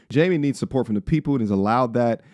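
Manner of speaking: ordinary talk, nothing marked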